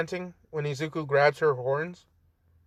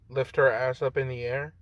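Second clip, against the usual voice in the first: ordinary delivery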